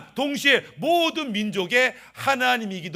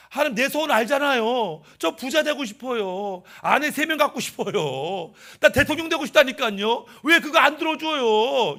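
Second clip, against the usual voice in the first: pleading complaint; 4 semitones higher, 4 dB louder than usual. Acted. Israelite begs God to grant his wishes, complaining. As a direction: nag for a wish, stretching the sentence endings. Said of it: "이러면서 자기의 진짜 소원을 안 들어주고".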